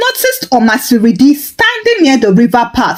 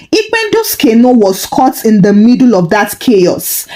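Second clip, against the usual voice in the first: ordinary delivery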